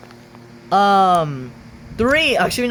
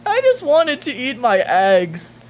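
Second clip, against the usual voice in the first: higher mock-crying voice; crying accent; 5 semitones higher than usual. Reading Riel's quote aloud in a mock crying accent. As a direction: whine it out as if in tears. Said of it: "the crying accent I did there".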